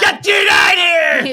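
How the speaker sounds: high, loud angry yell; mock shout. Ma yelling at the Mars bars in the freezer, mock shout. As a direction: bellow it at the freezer.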